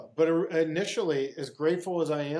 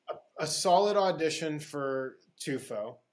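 normal talking voice

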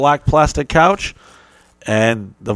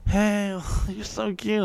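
high, breathy-free mock flirt; mock flirty teacher; 8 semitones higher, 8 dB quieter, slower than usual. Mimicking the teacher coming on to him, high. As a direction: coo it like a flirting woman.

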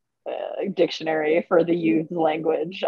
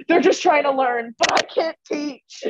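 higher, strained retold frustration; exasperated self-mimicry; 6 semitones higher, 3 dB louder, slower than usual. Coach catches herself being mean to novices, mock exasperation. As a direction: raise the pitch and draw out the ending. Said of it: "being genuinely mean".

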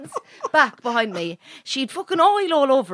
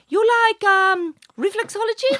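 high-pitched mimicked offer; masseuse; 10 semitones higher than usual. Masseuse offering client reflexology, high questioning imitation. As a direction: imitate her asking, voice way up and rising. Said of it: "she goes to me".

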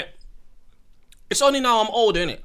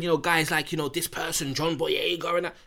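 ordinary delivery

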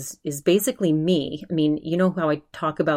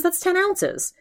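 imitating an outraged rant; mock indignant, pitch up. Ben ranting about the bony steak, mock outrage. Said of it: "he's outraged at the price".

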